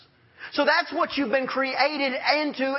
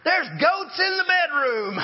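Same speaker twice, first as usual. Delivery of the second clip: retold scream; 6 semitones higher, slower than usual. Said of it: "I hear Crystal scream from the bedroom".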